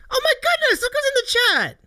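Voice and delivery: high, delighted burst at a friend in chat; excited surprise. Real, not acted. Streamer thrilled to see a friend in chat, high excited cry. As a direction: pitch leaps up as he spots her.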